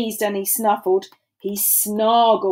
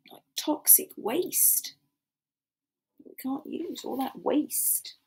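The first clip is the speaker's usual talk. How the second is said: hushed, softer aside; quiet aside; 3 semitones higher, 12 dB quieter than usual. Real, not acted. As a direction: drop the voice for an aside.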